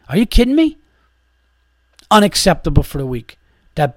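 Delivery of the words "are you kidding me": incredulous. Real, not acted. Disbelief at bartender's head shake.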